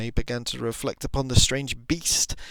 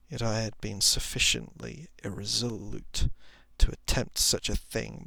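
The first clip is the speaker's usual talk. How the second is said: ordinary delivery, nothing marked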